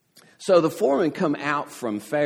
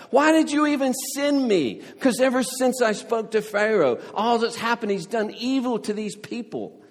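desperate complaint; 9 semitones higher, 3 dB louder than usual. Retold or imitated. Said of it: "he's saying to Yahweh".

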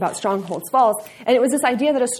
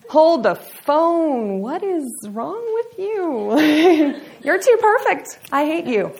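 mock outrage; 7 semitones higher, 5 dB louder, much slower than usual. Mock outrage at perfect girl.

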